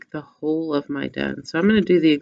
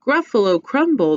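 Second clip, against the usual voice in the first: higher, louder, startled echo; alarmed Gruffalo; 6 semitones higher, 5 dB louder than usual. Acted. The Gruffalo echoing the mouse, higher and alarmed. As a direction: repeat it in a fright.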